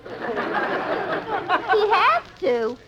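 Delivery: high, very slow puzzled question; puzzled echo. Acted. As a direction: stretch it out, baffled. Puzzled echo of friend's words.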